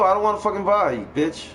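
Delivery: usual speaking voice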